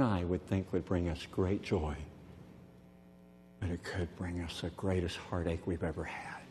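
hushed, breathless whisper. Real, not acted. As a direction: drop to a whisper to land the heartbreak. Sermon dropping to whisper.

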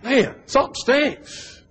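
high, slow disgusted sniff; smelly fellow sniffing. Retold or imitated. Man in the house, disgusted by the stink. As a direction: pinch your nose and complain. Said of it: "and he was like".

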